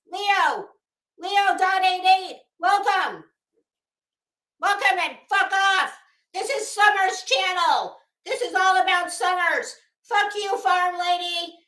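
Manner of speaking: hammed-up livestreamer parody; Prayer Garden of America imitation. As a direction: swing from sugary blessings to cursing. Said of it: "doing a Prayer Garden of America imitation".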